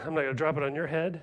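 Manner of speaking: usual speaking voice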